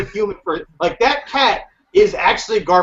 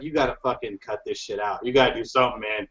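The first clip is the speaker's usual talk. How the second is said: much lower, softer stern voice of God; God's voice; 9 semitones lower, 8 dB quieter, faster than usual. Retold or imitated. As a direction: speak it low like a grave deity. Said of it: "like God told them".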